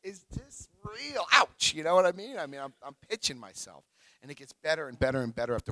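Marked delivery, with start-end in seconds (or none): 0.0-1.7 high, drawn-out disbelief; tearful amazement. Retold or imitated. Man overcome while driving to work, amazed. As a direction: marvel at it, pitch way up. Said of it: "start crying thinking".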